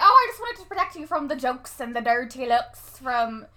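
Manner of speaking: loud mock Irish lilt; put-on Irish brogue; Irish accent. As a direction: imitate an Irish mom explaining herself. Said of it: "she's talking in an Irish accent".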